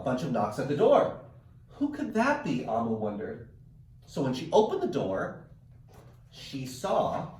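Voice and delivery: lively read-aloud storytelling; animated picture-book narration; reading to children. A narrator's reading. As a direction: read it like story time for kids. Teacher reads picture book aloud.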